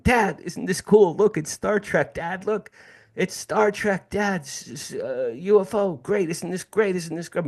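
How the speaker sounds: put-on high, eager kid voice gushing to his dad; thrilled kid. Thirteen-year-old begging his dad to look at the UFO, high and thrilled. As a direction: bounce with pure kid excitement, pitched high. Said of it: "I'm so happy".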